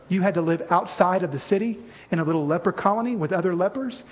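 ordinary delivery

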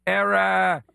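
thick mock Boston accent, slowing down; fake Boston accent. Host imagining himself showing up with a thick Boston accent. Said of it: "When were you from Boston?".

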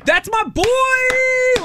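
very high, long drawn-out cheer; high-pitched, slow, gushing parental pride; proud parent cheer. Retold or imitated. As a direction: crow it proudly, stretching the last word. Retold proud parent cheering on son.

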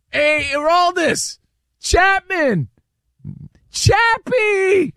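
high, slow shouted call across the stadium. Retold or imitated. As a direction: yell his name, rising each time. Fan shouting for Chapman.

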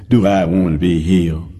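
low, scoffing mock question; muttered, disbelieving echo of the question. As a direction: toss it off as a foolish question. Man scoffs at a foolish question.

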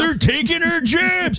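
very high, slow, hammed-up complaint; mock protest cry. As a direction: cry out in pretend outrage.

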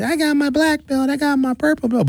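put-on high, boastful child voice; little karate kid showing off his belt; bragging little kid. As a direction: brag like a proud seven-year-old. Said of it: "little kids that be in karate".

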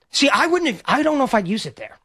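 normal talking voice

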